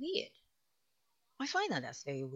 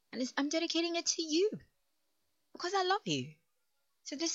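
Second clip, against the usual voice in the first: loving; 14 semitones higher, 6 dB louder than usual. Real, not acted. Loving dedication.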